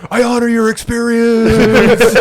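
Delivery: high, slow, put-on earnest voice; mock earnest sincerity. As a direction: drag it out in a fake solemn tone. Mocking therapist line in banter.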